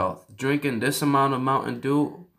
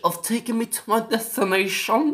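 high, over-polite fake British; mock British accent; 7 semitones higher than usual. Asking car for a ride, high mock British accent. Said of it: "How do they speak then?".

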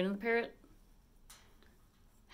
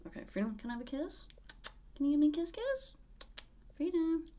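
high coaxing sing-song for the parrot; asking her parrot for a kiss in a high pet voice; 13 semitones higher than usual. Real, not acted. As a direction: coax the bird up high and sweet.

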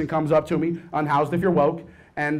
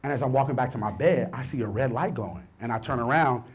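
ordinary talk

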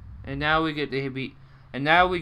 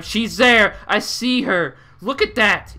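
loud, higher, urgent pointing out; excited alarm; 6 semitones higher, 8 dB louder, slower than usual. Real, not acted. Player excitedly points out the watching eyes on screen. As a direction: call it out like you just caught her watching.

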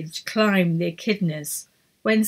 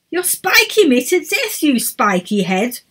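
indignant complaint; 6 semitones higher, 3 dB louder than usual. A narrator's reading. Emma complains.

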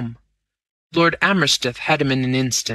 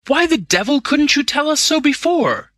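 high, exasperated outburst; indignant cry; 13 semitones higher, 4 dB louder than usual. A narrator's reading. Young Crowley rebuking him for not telling sooner, indignant cry. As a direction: cry out, voice pitched right up. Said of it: "he cried".

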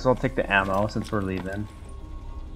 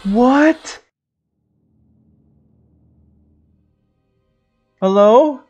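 shaken; 12 semitones higher, 7 dB louder, much slower than usual. Real, not acted. Still shaken after chase.

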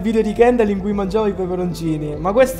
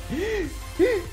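sharp gasp; 10 semitones higher, 8 dB quieter than usual. Real, not acted. Sudden in-game survival.

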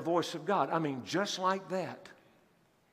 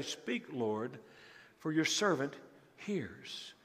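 much softer, lower, reverent reply; hushed prayer; 4 semitones lower, 8 dB quieter, slower than usual. Acted. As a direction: answer the Lord humbly, barely aloud. Eli teaches the boy's reply.

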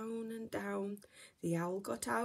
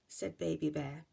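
plain reading voice

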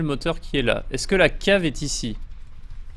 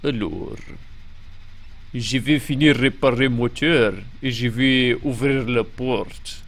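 low-pitched mock Russian accent; French with a put-on, low Russian accent; 4 semitones lower, slower than usual. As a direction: speak French like a comedy Russian. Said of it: "tenter d'utiliser l'accent russe".

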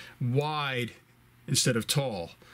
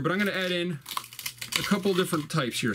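usual speaking voice

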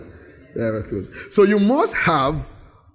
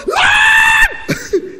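high, loud shout of Lord; a booming heavenly outcry; mock thunderous cry; 6 dB louder than usual. Preacher shouting Lord as God's thunder.

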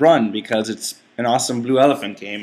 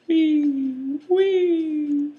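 squeaky high "wee, wee" like a toy; high squeal. Real, not acted. Gamer squealing as his character jumps.

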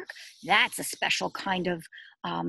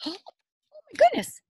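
surprised gasp; slower than usual. Acted. Teacher marvelling at the tiny hole for the children. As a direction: act amazed at the picture.

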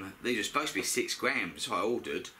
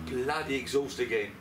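ordinary talk, nothing marked

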